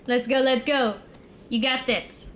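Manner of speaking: usual speaking voice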